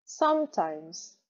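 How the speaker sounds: slow "Sometimes", weight on SOME; "Sometimes" slowly, stressed at the start; stress on first syllable. Shown as a demonstration. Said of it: "Gros rond en premier".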